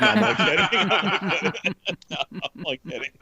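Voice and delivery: talking through laughter; real giggles breaking up the words; laughing. Real, not acted. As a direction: crack up while insisting. Cracking up at own joke.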